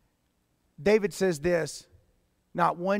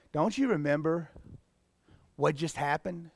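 quiet reproach; 4 semitones lower, 7 dB quieter than usual. Retold or imitated. Moses voiced reproaching the people.

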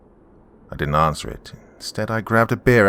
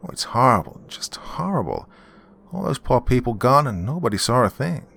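higher-pitched mock horror, lightly pleased; gossiping neighbour in a raised voice; almost happy; 6 semitones higher than usual. A narrator's reading. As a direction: relish the awful news a little. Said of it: "He sounded almost happy about it".

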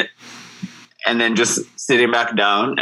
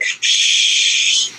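hushing. Retold or imitated. Hushing sister while half asleep.

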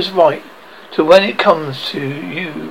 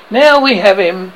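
raised, louder triumphant shout; 4 semitones higher, 5 dB louder than usual. A narrator's reading. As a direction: shout it as the pirate captain. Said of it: "Hook shouted".

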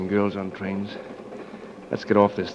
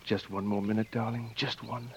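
hushed; 2 semitones lower, 14 dB quieter than usual. Acted. Lovers lingering in the forest.

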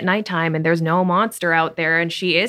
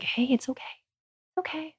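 very soft, higher-pitched soothing; gentle reassurance; 7 semitones higher, 10 dB quieter than usual. Acted. Approaching her scared younger self in a dark room, gently reassuring. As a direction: coax gingerly, hushed and tender. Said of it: "gingerly walking towards this scared being".

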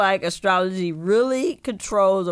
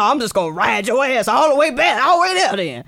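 worked-up vow, rising higher; acted road rage; 4 semitones higher, 3 dB louder than usual. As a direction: retell stubborn driver's resolve with mounting pitch. Acting out stubborn road rage.

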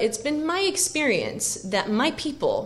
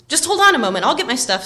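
loud, high, eager burst; bright-eyed eagerness, raised voice; 5 semitones higher, 8 dB louder, faster than usual. A narrator's reading. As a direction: call out in a hurry to join in. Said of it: "said Sam eagerly".

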